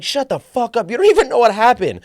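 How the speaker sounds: high, fast furious yelling; yelling angrily at the lady on the plane; retold scream. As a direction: scream it back at her. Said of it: "So I start screaming".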